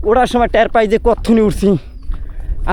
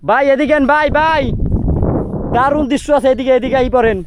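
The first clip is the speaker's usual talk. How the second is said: high, carrying call to a friend; 6 semitones higher than usual. Real, not acted. Calling his brother over to the viewpoint, high, carrying call. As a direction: shout him over to see the view.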